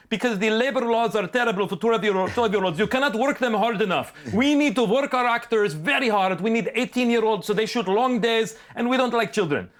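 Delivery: put-on high, matter-of-fact German accent; mock German accent. German director explaining child labor laws, mock German accent. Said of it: "directors sound like Hans and Franz".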